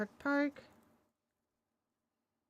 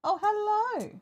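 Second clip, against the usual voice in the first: high, loud, delighted hello to the dog; excited greeting; 11 semitones higher, 6 dB louder, slower than usual. Real, not acted. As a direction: burst out happily at the dog.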